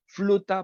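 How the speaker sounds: fluta, with a clear fl cluster in place of fr; child mispronunciation. Shown as a demonstration. Child saying fluta instead of flauta, mispronounced. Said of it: "el niño que pronuncia".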